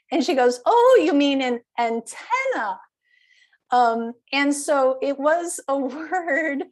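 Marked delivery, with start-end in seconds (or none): 0.6-2.8 ANTENNA stressed on the second syllable, an-TEN-na; higher, louder realization, hitting TEN in antenna; corrected stress. Retold or imitated. Woman finally understanding antenna, stress moved to TEN. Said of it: "just that difference between antenna and antenna".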